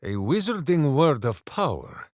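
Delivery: ordinary talk, nothing marked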